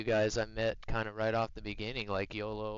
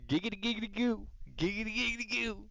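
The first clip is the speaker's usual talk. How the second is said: high giggity catchphrase, climbing as it repeats; Quagmire's giggity, higher with each round; Quagmire impression; 8 semitones higher, faster than usual. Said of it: "Family Guy voices".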